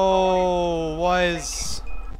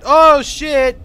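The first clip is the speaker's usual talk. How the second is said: louder panicked oh shit; startled; 5 semitones higher, 9 dB louder than usual. Real, not acted. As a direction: blurt it out in fright. Foxy appears.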